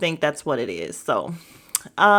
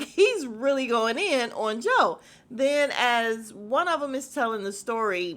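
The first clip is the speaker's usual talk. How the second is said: ordinary talk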